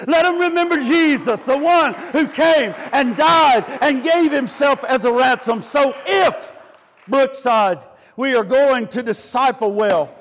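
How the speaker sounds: insistent repetitions climbing to a shouted peak; rousing pulpit exhortation; full-force preaching. Real, not acted.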